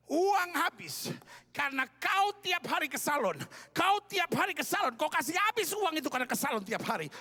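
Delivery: loud, high quarrelling rant; acted furious husband shouting accusations; angry husband. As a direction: lash out at your wife, loud and high. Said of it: "suaminya marah".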